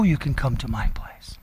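whispered "I'm gonna go to your place so you can come to my place". Retold or imitated. Jesus promising to come to our place, whispered. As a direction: breathe "to your place" intimately, unvoiced.

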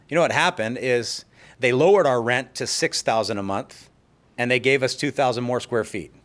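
usual speaking voice

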